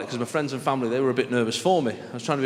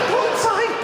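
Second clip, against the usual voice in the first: much higher, louder squeak; shocked disbelief; 5 dB louder than usual. Retold or imitated. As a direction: shriek it in disbelief. Shocked repeat.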